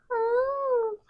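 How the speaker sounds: soft, high, drawn-out "oh"; imitated crowd ooh. Slytherins watching Harry taken away, soft high ooh. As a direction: let out a long, quiet, high ooh.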